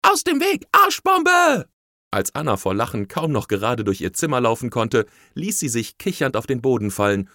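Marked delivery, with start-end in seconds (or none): 0.0-1.7 very high, loud cannonball yell; excited kid's high-pitched holler; gleeful shout. A narrator's reading. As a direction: shout a warning as you leap. Paper child jumps off, shouting warning.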